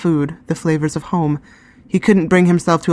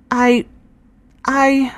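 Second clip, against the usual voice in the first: stammering; 9 semitones higher than usual. Acted. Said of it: "she stammered".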